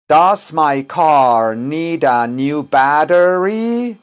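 very slow and deliberate; "Does" lifted and stretched; slow model sentence. Shown as a demonstration. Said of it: "Repeat after me". (pregunta modelo muy lenta y marcada)

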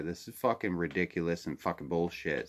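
ordinary talk, nothing marked

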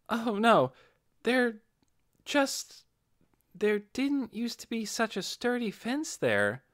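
put-on high, slow, hesitant young voice with halting pauses; the boy Inferia trails off, unsure of himself; Inferia's voice. Inferia to the group, halting and high-pitched.

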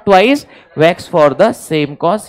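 low, slow completion of the maxim; measured, drawn-out drilling; slow recitation. Shown as a demonstration. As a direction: finish it word by word for the class. Dictating maxim for class to repeat.

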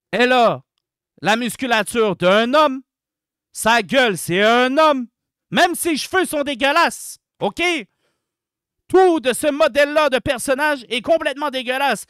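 loud, emphatic condemnation; forceful, indignant verdict; disgusted rant. Real, not acted. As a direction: declare it loudly in outrage.

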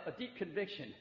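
ordinary delivery

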